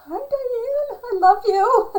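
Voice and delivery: high, loud, laughing squeal; laughing affection. Real, not acted. Talker fondly greeting Diane, laughing and squealing. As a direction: gush it through a burst of laughter.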